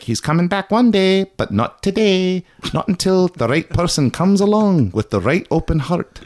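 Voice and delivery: high, singsong cleric voice; high-voiced mock prophecy; Klingon clerics. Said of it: "And the clerics are like".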